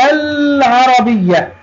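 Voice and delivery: العربية slowly, holding a clear l; high, slow demo sounding the l of العربية; pronounced lam. Shown as a demonstration. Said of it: "طالما نطقناها فوقها سكون". (نطق «العربية» ببطء مع إظهار اللام الساكنة)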